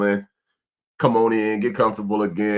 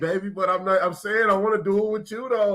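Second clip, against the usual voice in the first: pleading high voice; 11 semitones higher than usual. Retold or imitated. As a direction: voice jumps way up, pleading with his wife. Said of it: "and then I be like".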